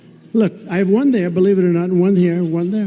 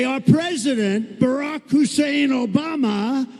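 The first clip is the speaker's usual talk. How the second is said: high, slow, every name stretched out; drawn-out announcement; 6 semitones higher, 3 dB quieter, slower than usual. Retold or imitated. Mocking Biden at rallies, slow and high announcement. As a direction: stretch out "Barack Hussein Obama" high and slow like a ring announcer. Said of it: "and I say".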